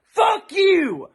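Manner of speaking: loud, real anger; angry outburst; furious cursing. Real, not acted. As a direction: hurl the curse at them. Cursing the rioters outright.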